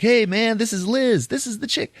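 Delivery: very high, hammed-up eager voice; high excited boy. [name] introducing Liz to Reggie, high and excited.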